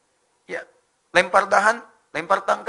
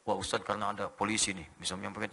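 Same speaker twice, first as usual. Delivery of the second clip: questioner; 9 semitones lower, 10 dB quieter than usual. Retold or imitated. Acts out a questioner's doubt.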